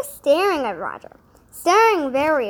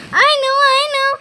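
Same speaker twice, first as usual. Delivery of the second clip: much higher, louder eager chatter; chattering excitedly; 12 semitones higher, 10 dB louder, much faster than usual. Acted. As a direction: blurt it out, bursting to tell. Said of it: "chattered the cat".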